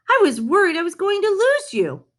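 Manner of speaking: high-pitched relieved exclamation; worried relief. A narrator's reading. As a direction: let the worry spill out, voice lifted. Walt greets the dog, relieved.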